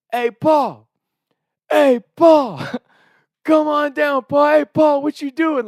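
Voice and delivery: high, loud drawn-out call up the stairs; mock taunting yell. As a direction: needle dad with a loud singsong. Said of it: "he just keeps hearing us".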